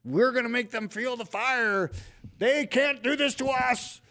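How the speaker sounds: high, loud mocking shout; mock rally shout. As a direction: fire up a crowd, mockingly. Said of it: "rally demanding".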